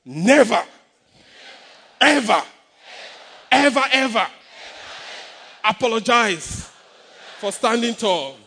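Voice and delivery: loud, defiant, each never and ever hammered apart; with attitude. Shown as a demonstration. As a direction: fling it at your neighbor with attitude. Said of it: "I say it with an attitude".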